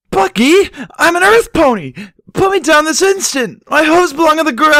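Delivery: very high, very loud outraged squeal; indignant shriek. Acted. Earth pony shrieks at Bucky while floating, very high and loud. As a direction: shriek at him to put you down. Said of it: "Barry cried".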